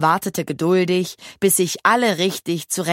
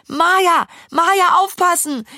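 high, loud cry of alarm; shrieked warning; 10 semitones higher, 5 dB louder than usual. A narrator's reading. Lille warns Maja at the curve, shrieking. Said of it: "kreischte Lille".

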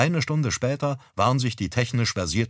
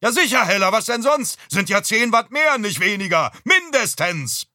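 much higher, loud exasperated yell; heated shouting; 12 semitones higher, 5 dB louder than usual. Acted. Father shouts back toward the kitchen, loud and high.

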